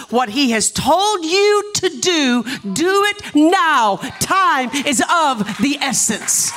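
high, slow, ringing proclamation; forceful preaching. Real, not acted. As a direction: proclaim each phrase to the congregation. Preaches the same message to church.

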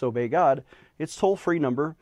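ordinary talk

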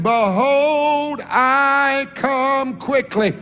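high, loud, very slow proclaiming; pushing even further. Acted. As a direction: drag out quickly for the crowd. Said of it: "He leaned out a little bit further".

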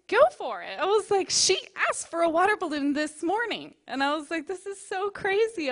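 loud, high excited exclaiming; retold joy at a small miracle; thrilled. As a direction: burst out in amazed delight.

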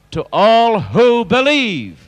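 loud, high shout, stretched on the key words; ringing, high-pitched preacher's cry; shouted proclamation. Shown as a demonstration. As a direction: shout it out to the whole congregation. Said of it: "Shout it".

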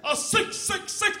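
high, slow triple repeat; mock preacher's triplicate; preacher dad ordering. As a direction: draw it out like a preacher. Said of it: "Here's my dad ordering".

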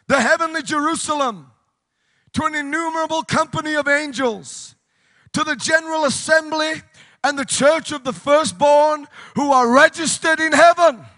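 impassioned pulpit delivery, peaking loud; rousing preaching. Real, not acted. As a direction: lift the scripture into a ringing proclamation. Scripture reading rises into preaching.

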